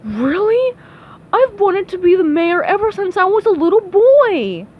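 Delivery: high, loud, overjoyed; beaming delight. Acted. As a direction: gush like a lifelong dream came true. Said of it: "The man beamed".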